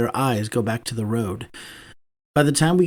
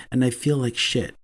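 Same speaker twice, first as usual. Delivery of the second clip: plain reading voice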